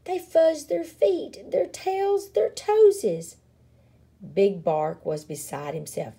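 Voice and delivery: ordinary delivery, nothing marked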